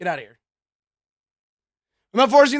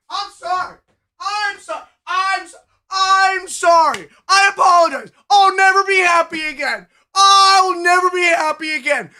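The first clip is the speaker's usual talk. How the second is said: mock crying; 3 semitones higher than usual. Streamer wails a fake sobbing apology to chat, hammed up.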